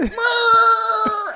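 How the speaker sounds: high loud shriek like a coffee machine; shrieking machine noise. Pretend. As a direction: screech out the machine sound. Coffee machine finally sounds.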